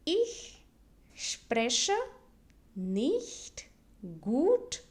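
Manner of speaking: slow German, each of "Ich", "spreche", "nicht", "gut", "Deutsch" set apart; word by word. Shown as a demonstration. Teacher models the sentence for beginners, slowly word by word. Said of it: "let me repeat it".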